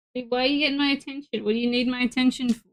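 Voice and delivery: quick, soft chatter to the pet; fast. Real, not acted. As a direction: rattle it off fast and hushed. Pet demanding attention.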